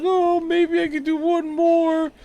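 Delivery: high, drawn-out mimicry of her; wistful mock mother. Mother musing after the first birth, high mock-wistful voice. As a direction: sing it out high and slow.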